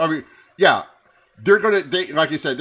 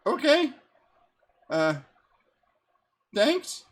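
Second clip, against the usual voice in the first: high, awkward thanks; awkward npc; 10 semitones higher, slower than usual. Acted. Startled summoner thanking departing elemental, high and awkward. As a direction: pitch it up, unsure and polite. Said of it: "She goes".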